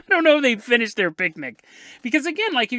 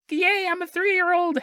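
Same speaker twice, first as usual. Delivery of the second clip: higher, chirpy mock toddler voice; cheery toddler; 9 semitones higher than usual. Host guessing how the boy really sounded, higher mock toddler voice. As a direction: squeak it like a happy three-year-old. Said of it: "however three-year-olds sound".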